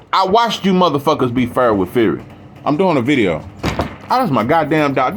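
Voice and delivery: normal talking voice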